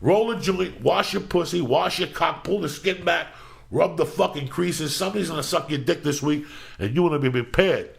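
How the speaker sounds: high, pumped-up motivational preaching; pep talk rant. Acted. Host rallying listeners for Monday, high pumped-up pep talk. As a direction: hype the listeners up for the week.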